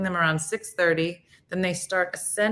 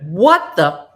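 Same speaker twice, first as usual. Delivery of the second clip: louder, snapping mock anger; mock irritated outburst; 8 dB louder than usual. Joking about dropping the smile, a louder mock outburst. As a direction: bark it like a rude stranger.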